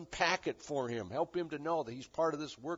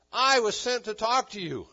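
loud, higher, firm pronouncement; emphatic declaration; 7 semitones higher, 8 dB louder than usual. Acted. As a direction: lay down the mission as the angel. Acts Gabriel laying it out.

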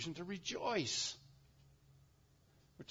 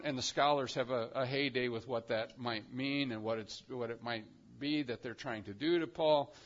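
ordinary delivery

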